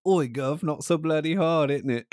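high, loud mock British protest; British accent. The safe word from the meme, yelped British accent. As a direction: yelp it in a hammed-up British accent. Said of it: "try to say it in a British accent".

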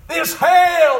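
loud, high pulpit cry; retold deathbed cry. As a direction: cry it out for the dying man. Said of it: "he cried out".

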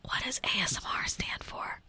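whispered rephrased query to Siri; repeating to voice assistant. Real, not acted. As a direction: whisper the question again, clearer.

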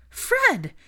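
high, loud, shocked exclamation; alarmed cry. A narrator's reading. Molly sees her son lying unconscious, alarmed.

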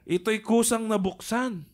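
dramatic reading. Pretend. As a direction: read it like a charismatic preacher. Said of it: "Natatawa lang ako sa pagkaka-deliver mo".

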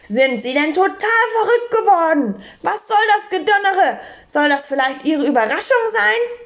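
high-pitched, indignant scolding; empörtes Losschreien. A narrator's reading. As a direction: scold him in a high, outraged voice. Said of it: "und schrie los". (hohes, empörtes Schimpfen)

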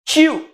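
"Tio" said high and drawn out as one syllable; syllable sounded out. Shown as a demonstration. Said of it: "Nesse tio, aí o que é que eu tenho?".

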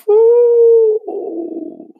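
whew. Real, not acted. Marveling at candy bags.

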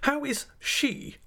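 very high, pointed demand; sharp. A narrator's reading. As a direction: press sharply, drawing out the question. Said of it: "said my aunt sharply".

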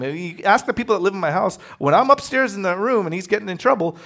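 ordinary talk, nothing marked

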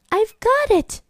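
high sudden burst of an idea; excited cry. Acted. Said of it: "she cried".

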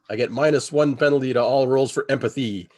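ordinary talk, nothing marked

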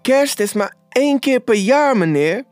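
high, anxious plea; pleading. A narrator's reading. Robert begs his boss for leniency, high pleading. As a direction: beg with the pitch pushed up. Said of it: "smeekte Robert".

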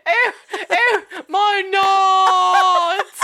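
high, loud laughing British yelp; mock British cry. Imitating the British actor's cry, high loud laughing yelp. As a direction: wail it like a pained Brit. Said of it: "but he's British".

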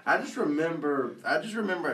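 laughing through the words; giggling while talking. Real, not acted. Laughing after being teased.

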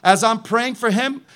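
ordinary talk

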